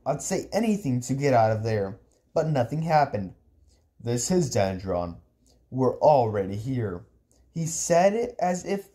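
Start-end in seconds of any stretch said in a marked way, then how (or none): 4.0-7.0 put-on low, matter-of-fact older relative; low, composed uncle voice; calm uncle. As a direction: drop the pitch and state it evenly.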